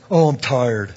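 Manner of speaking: mildly mock a tired complainer; griping. Young man grumbling to his interviewer that he's tired, griping. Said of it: "he was kinda griping".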